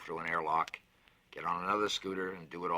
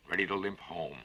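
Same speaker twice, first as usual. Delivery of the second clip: ordinary delivery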